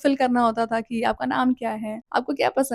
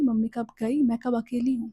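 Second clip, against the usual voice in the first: ordinary delivery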